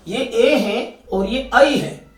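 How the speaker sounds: ए and आई said slowly so they sound distinct; teacher contrasting ए and आई; careful vowel demo. Shown as a demonstration. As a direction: slowly set ए against आई. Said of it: "बहुत सारे बच्चे उच्चारण पे करते हैं".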